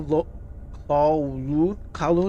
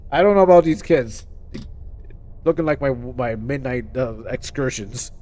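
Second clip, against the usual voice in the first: mock principal; 6 semitones lower than usual. Imitating the principal complaining about the kids, lightly voiced. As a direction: grumble like a weary school head. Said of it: "the principal's like".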